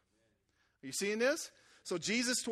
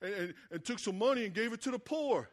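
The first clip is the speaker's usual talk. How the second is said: complaining disciples. Retold or imitated. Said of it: "the disciples start complaining".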